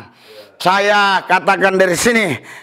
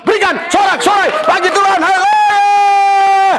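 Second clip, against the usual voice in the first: building to a shout; 10 semitones higher than usual. Real, not acted. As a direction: climb from urgent preaching into a jubilant cry. Preacher builds to a climax.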